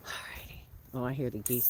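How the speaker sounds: breathy whispered alrighty. Real, not acted. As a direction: whisper it so the animals don't startle. Quiet moment by the geese.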